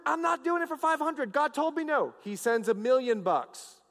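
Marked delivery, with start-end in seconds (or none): 0.1-2.1 high-pitched acted refusal; refusing prophet. Balaam answering the king's bigger bribe, acted high-pitched refusal. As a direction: turn the offer down in a raised pitch. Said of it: "Balaam goes".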